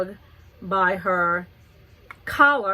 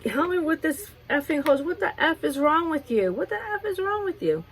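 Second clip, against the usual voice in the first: retold screaming at neighbor; 8 semitones higher than usual. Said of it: "she's screaming at him".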